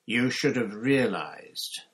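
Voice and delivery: ordinary delivery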